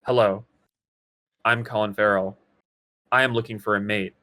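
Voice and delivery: awkward flat movie acting; deadpan robotic. Shown as a demonstration. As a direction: recite it like a stiff robot with no feeling. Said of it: "Everyone in the movie talks like this".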